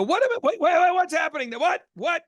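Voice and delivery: high, startled wait-what outburst; shocked disbelief. Retold or imitated. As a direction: stop him mid-sentence in disbelief. Retold shock at absurd request.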